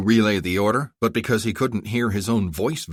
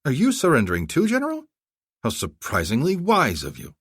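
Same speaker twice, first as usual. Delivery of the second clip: taunting; 8 semitones higher than usual. Acted. Taunting the enemy general.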